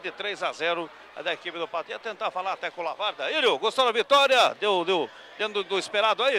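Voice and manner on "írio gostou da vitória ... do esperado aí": loud, raised-pitch question; calling out. Real, not acted. Reporter catches a player after the win, calling out. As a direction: call to the player by name, loudly.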